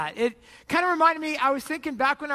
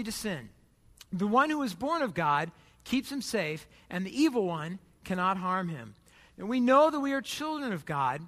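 scripture reading; 3 semitones lower than usual. Acted. Reads scripture aloud.

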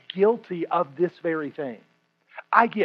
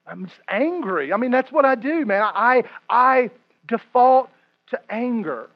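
ordinary talk, nothing marked

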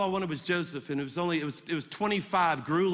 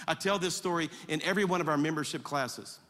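ordinary talk, nothing marked

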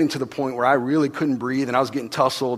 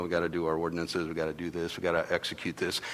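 mock dutiful drone; 10 semitones lower, 13 dB quieter than usual. Mocking ritual duty at temple.

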